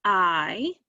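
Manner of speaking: "I" held as a long vowel; long I sound. Shown as a demonstration. Teacher demonstrating the first syllable's vowel, long "I" on its own. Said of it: "we had a long I sound".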